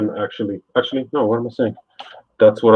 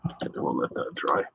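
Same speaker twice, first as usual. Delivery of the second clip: whispered muttering to himself; whispering; 4 semitones lower, 12 dB quieter than usual. Real, not acted. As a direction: murmur under your breath, half voiced. Muttering to himself while painting.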